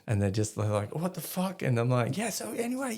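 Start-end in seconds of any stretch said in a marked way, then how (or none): 0.9-1.6 baffled. Retold or imitated. Soldiers' baffled reaction. 2.2-2.9 breezy. Retold or imitated. Brushing it off.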